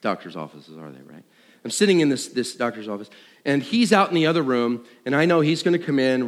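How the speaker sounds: ordinary delivery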